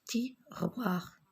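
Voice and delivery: "Tiroir" whispered with no voice; whispered word. Shown as a demonstration. Teacher to pupils, shows a picture, whispered. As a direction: breathe out "Tiroir" softly. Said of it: "regardez l'image".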